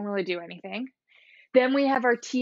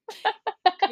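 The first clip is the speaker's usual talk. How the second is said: high burst of laughter; 10 semitones higher, 4 dB louder than usual. Real, not acted. Laughing at cohost's joke.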